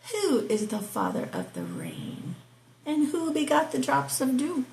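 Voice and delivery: measured, declaiming Bible reading; scripture reading. Acted. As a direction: read it out like from the pulpit. Reads Job 38 aloud.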